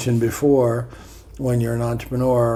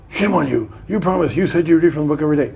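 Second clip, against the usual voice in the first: mock scolding; 5 semitones higher, 4 dB louder, faster than usual. Scolding viewer voiced mock-accusingly.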